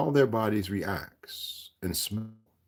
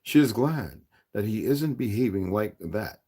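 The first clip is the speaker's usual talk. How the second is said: usual speaking voice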